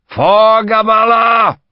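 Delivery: high, long-drawn answering battle shout; war cry response. A narrator's reading. Surviving men answering their commander, shouting the war cry. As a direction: roar the cry back, stretching it. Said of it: "the eighty-seventh responded".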